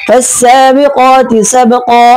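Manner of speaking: slow melodic tajweed chanting; measured Quran recitation with drawn-out verse ends; Quranic recitation. Shown as a demonstration. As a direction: chant each verse slowly, stretching the endings. Recites Surah Naziat.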